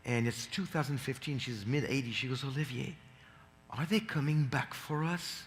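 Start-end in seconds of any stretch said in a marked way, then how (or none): 2.4-5.4 hushed, mildly fearful quote; retold tearful fear. Mother on the phone asks her son, quietly tearful. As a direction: quietly imitate a mother's frightened question. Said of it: "She starts crying".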